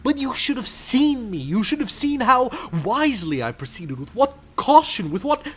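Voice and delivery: high, loud and building to a pitch; feverish boasting. Acted. Madman bragging to the listener of his caution, rising feverishly. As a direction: let the pride run away with you.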